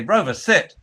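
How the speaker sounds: loud high "Rover, sit"; alarmed shouted command; urgent shout. Retold or imitated. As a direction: yell it before the dog reaches the cliff. Dog chasing seagull to cliff.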